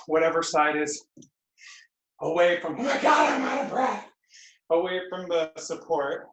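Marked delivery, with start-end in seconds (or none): 2.8-4.1 high, loud mock-panting complaint, hammed-up; mock out of breath. Instructor voicing tired students, hammed-up breathless cry. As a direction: play the winded student, overdoing it.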